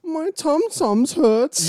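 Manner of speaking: put-on very high, slow baby talk. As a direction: whine it like a toddler.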